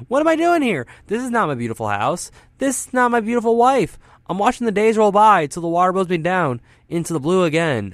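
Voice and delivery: high, loud theatrical recitation; dramatic song quote. Acted. DJ breaking into quoted lyrics as a bit, loud and theatrical. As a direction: declaim the lyrics with mounting exasperation, pitch leaping up. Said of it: "just quote the rest of Once in a Lifetime".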